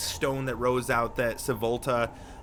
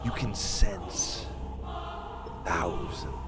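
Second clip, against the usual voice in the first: slow, softer and foreboding, swelling toward the end; game master's ominous narration; 5 semitones lower, 4 dB quieter than usual. Acted. Game master showing players the dark underworld, slow and ominous. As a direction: draw them down into dread, hushed then intensifying.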